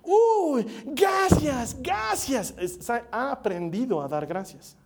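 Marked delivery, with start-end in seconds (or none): 0.0-2.5 put-on high, bright baby voice; a little girl squealing thanks; toddler voice. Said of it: "porque agarras y le das algo".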